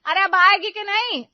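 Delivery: very high, loud nagging yell; impatient shout. Acted. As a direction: shout as if out of patience. सास अधीर होकर चिल्लाती है. (झल्लाकर चिल्लाना)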